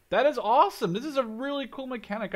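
a burst of real excitement; thrilled. Real, not acted. Streamer praising a cool new mechanic, thrilled.

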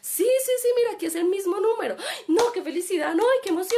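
overjoyed squeal. Retold or imitated. Retelling the shared joy over the winning ticket, squealing. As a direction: gush as if you just won big. Both celebrating lottery win, retold.

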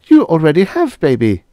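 put-on high, loud, oddly cheerful alien girl; Venusian girl. Venusian girl telling a frightened woman. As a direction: chirp it out like a blunt alien child.

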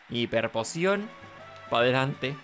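ordinary talk

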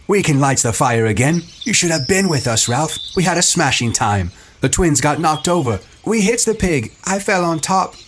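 high, breezy excitement; eager boasting. A narrator's reading. Happy Jack telling Ralph of the hunt, excited. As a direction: gush it out, pitched high.

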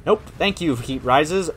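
ordinary delivery

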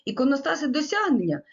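a hint of a sarcastic small-town teacher; quoting a colleague. Lecturer quoting a teacher's answer about the wall, lightly mocking. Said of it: "отримав такі відповіді".

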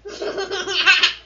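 loud mock villain laugh; evil chuckle. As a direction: cackle like a villain. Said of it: "É a risada do mal".

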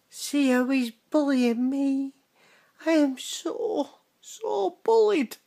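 high-pitched, drawn-out fake pleading; mock bullied whine. Tattling to Retro's wife about being bullied, mock whine. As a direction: play the pitiful victim, slow and high.

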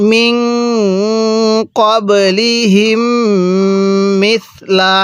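slow tajweed, held nasals, soft th in মিসলা; measured model reading of মিন ক্বাবলিহিম মিসলা; soft "tha" in recitation. Shown as a demonstration. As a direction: soften the s of মিসলা to "th". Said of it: "এই সাটাকে নরম করে উচ্চারণ করতে হবে".

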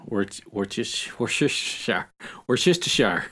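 "Worcestershire" built up piece by piece, "Worc-", "Worcester-", then the full word; coaching the word. Shown as a demonstration. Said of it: "I can't say that fucking word".

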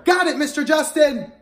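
loud cheerful call-back. Shown as a demonstration.

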